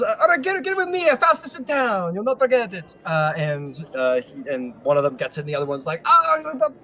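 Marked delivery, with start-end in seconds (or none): 0.0-2.9 high-pitched hustling gnome cabbie; comic little Italian driver. Acted. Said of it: "I've decided gnomes are Italian". 6.0-6.7 high, loud gnome outburst; comic squeaky bickering; yelling gnome. Acted. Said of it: "yelling at each other".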